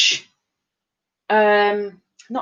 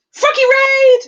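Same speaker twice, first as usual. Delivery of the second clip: high, loud raid announcement; big high-pitched cheer; excited call-out; 8 dB louder than usual. Real, not acted. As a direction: holler it to the room. Calling out a raid arriving.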